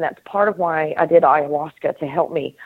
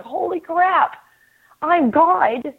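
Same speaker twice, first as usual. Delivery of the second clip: mock-lonely God voice, pitched much higher; 6 semitones higher than usual. God realizing he is alone, in a much higher whiny voice. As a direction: play God whining that he is all alone.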